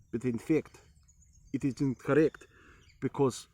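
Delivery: mock Slavic accent to suit the name; put-on Slavic accent. Shown as a demonstration. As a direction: talk in a put-on Eastern European accent. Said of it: "I'm just switching between characters".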